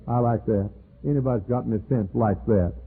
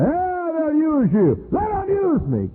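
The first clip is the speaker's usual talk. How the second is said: much higher and drawn out, mock complaint; high, slow voice of the users; 3 dB louder, slower than usual. Preacher voicing those who'll use him, high and slow. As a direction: stretch it up high and slow, playing the ungrateful. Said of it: "So they".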